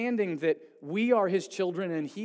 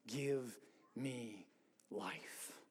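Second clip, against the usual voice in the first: slow, softening to a whispered "life."; prayer dying away to a whisper; fading plea; 3 semitones lower, 6 dB quieter, much slower than usual. Retold or imitated. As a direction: let the prayer fade into a whisper. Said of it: "He says".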